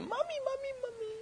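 very high, soft whining mommy mommy; mock toddler whine, hammed up; whiny child voice. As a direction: whimper it like a grown man acting a toddler. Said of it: "whining and crying and saying".

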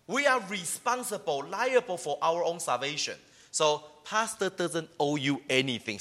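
normal talking voice

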